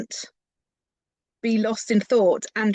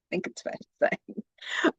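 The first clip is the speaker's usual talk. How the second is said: soft, slow whispered aside; 6 dB quieter, slower than usual. Real, not acted. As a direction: drop to a whisper. Wry aside after laugh.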